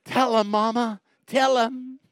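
lightly voiced little boy; little boy urging mama to tell; boy's plea.

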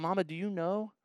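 put-on small boy asking his mom in church; little boy whisper. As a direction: ask mama quietly. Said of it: "the boy turns to his mom".

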